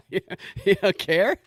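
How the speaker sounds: slow, high disbelieving question; incredulous. Real, not acted. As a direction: ask it in laughing disbelief.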